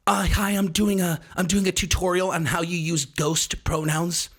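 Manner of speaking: put-on TikTok tutorial voice; lunatic TikTok person. TikToker presenting a tutorial on pronouns, mock lunatic. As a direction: parody an earnest TikTok tutorial host. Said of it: "the most lunatic TikTok people on TikTok".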